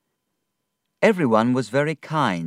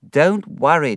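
louder, raised reassurance; reassuring; 7 semitones higher, 7 dB louder than usual. Acted. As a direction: cheer up a worried friend. David reassures sad friend.

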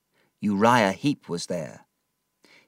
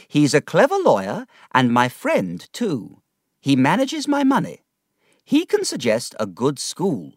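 put-on higher, decided old aunt voice; brisk aunt; 7 semitones higher, 5 dB louder than usual. Aunt deciding David's schooling, brisk. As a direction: set out the plan firmly.

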